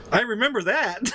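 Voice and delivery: smiling. Retold or imitated. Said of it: "then he smiles and he goes".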